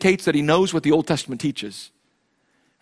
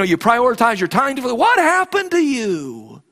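preaching at full force; 5 semitones higher than usual. Acted. Pastor urging his congregation to share their faith, preaching at full force. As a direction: preach it hard to the congregation, building. Preacher rises again, voicing friends.

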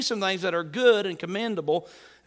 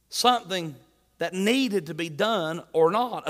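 ordinary talk

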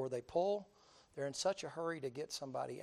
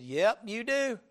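higher, loud knowing yep; Bubba agreeing; 8 semitones higher, 12 dB louder, slower than usual. Retold or imitated. Bubba agreeing with the officer, loud and knowing. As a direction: drawl it like you've seen it all. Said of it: "He said".

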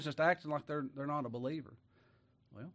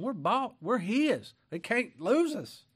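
rising preaching; 7 semitones higher, 4 dB louder, much slower than usual. Real, not acted.

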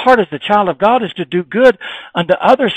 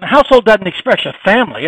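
ordinary delivery

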